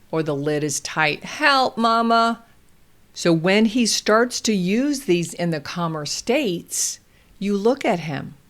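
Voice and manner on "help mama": put-on high, level toddler call for help; child calling mama. Toddler asks mom about a tight lid, high simple call. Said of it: "he can say".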